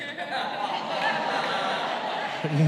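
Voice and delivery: airy helpless laugh; breathy laugh. Real, not acted. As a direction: laugh before admitting it. Laughing at son's question.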